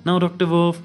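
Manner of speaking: ordinary talk, nothing marked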